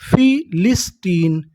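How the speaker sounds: फिलिस्टीन slowly, split as फि, लिस, टीन; slow teaching breakdown of फिलिस्टीन; syllable by syllable. Shown as a demonstration. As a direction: sound out फिलिस्टीन in three separate beats. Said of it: "तो meaning क्या बनी".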